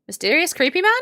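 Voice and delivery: ordinary talk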